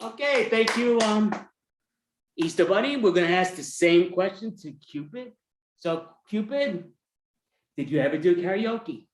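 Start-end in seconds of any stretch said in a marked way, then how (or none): none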